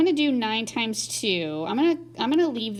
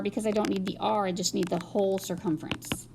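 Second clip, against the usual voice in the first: usual speaking voice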